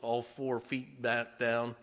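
normal talking voice

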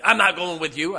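put-on an old lady yelling her refusal; cranky refusal. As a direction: shove him away with the voice.

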